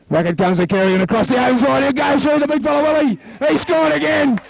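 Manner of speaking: high, rising play-by-play as the try is scored; excited try call. Real, not acted. Live try call rising to scoring.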